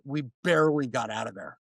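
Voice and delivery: ordinary talk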